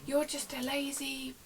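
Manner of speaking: retold slow, high-pitched insult; rude caller. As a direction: imitate an insulting customer, drawn out. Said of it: "being really rude".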